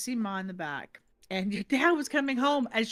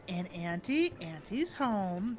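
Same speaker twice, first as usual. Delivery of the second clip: usual speaking voice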